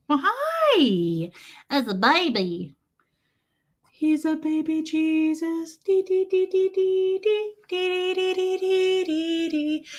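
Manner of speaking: put-on high singsong cooing at a baby; baby talk. Streamer cooing at a baby Jesus, high singsong baby talk. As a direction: coo up high like talking to an infant.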